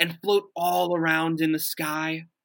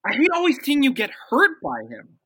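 raised, upset plea; heated; 9 semitones higher, 6 dB louder than usual. Acted. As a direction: push back, louder and higher. Couple arguing about his father.

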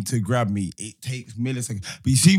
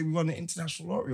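rushed scammer line; 7 dB quieter, much faster than usual. Retold or imitated. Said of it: "they phone and say".

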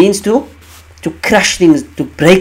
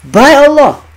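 By Allah punched high and loud; emphatic oath; 8 semitones higher, 4 dB louder, slower than usual. Shown as a demonstration. Teacher repeating the oath to show emphasis, forceful. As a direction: hit By Allah with full weight. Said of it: "That's emphasis".

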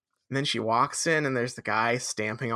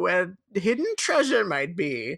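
slow, raised grand-mentor voice; Dumbledore; 5 semitones higher than usual. Acted. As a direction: draw out the wizardly wisdom, pitched up. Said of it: "he is the Dumbledore, and he says".

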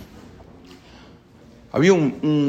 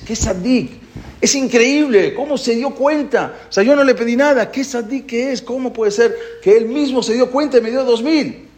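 higher, excited praise, the voice lifted high; amazed delight; 10 semitones higher than usual. Acted. Grateful man marvelling at his generous friend, excited.

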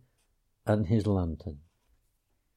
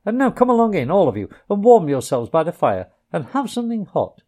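higher, louder hearty welcome; hearty Rat; 6 semitones higher, 5 dB louder, faster than usual. A narrator's reading. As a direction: usher the guests in warmly. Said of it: "cried the Rat heartily".

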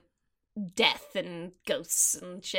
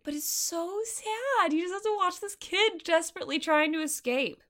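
high, plaintive lament; pained sympathy; 8 semitones higher than usual. Real, not acted. Podcaster pitying the kid trying to escape, plaintive. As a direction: draw out the pity in a high voice.

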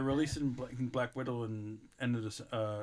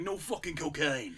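put-on mild Irish English accent; 4 semitones higher than usual. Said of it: "Irish English accent".

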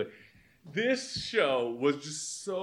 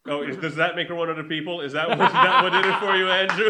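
mock sarcastic; 9 dB louder than usual. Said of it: "And I was like".

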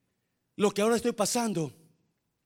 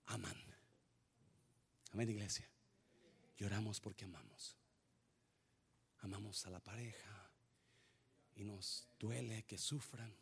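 much softer, low and intimate; hushed, tender preaching; 9 semitones lower, 17 dB quieter than usual. Real, not acted. Pastor softly telling the church why people cry, tender hush. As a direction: draw them in close and gentle.

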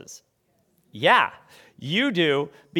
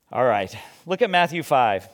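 ordinary talk

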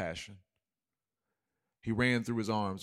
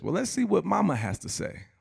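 higher, louder sarcastic professor; mocking professor; 8 semitones higher, 4 dB louder than usual. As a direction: tease him about his mama, smug. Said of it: "the professor says".

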